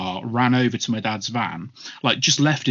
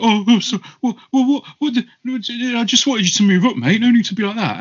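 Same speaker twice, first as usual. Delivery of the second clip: much higher, louder, panicky backpedalling; flustered high voice; 12 semitones higher, 5 dB louder than usual. Retold or imitated. Driver grabbed by dad, panicky high squeak. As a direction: squeak like a man caught out and scared. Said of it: "The guy was like".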